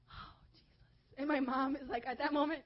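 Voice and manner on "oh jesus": slow, very soft whisper; hushed relief. Retold or imitated. Storyteller watches dad put out the fire, slow hushed whisper. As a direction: breathe it out slowly, barely voiced. Retold breathless reaction after fire.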